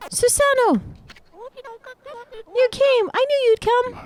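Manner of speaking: very high, thin voice for the character. Acted. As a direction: pitch way up for the game character. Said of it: "You wanna do Cushy's voice?".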